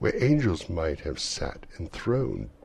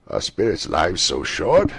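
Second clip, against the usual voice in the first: put-on old Scrooge; 9 dB louder than usual. Scrooge asking the ghost.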